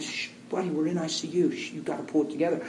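soft, whispered calming; quiet him down in a hospital hall; hushed shush. Retold or imitated. Father hushing son in ICU.

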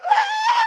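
very high comic crying wail; bawling wail. Retold or imitated. Husband bawling along with his wife, exaggerated high wail. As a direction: let out a mock high-pitched sob. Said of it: "she's bawling, and I'm going".

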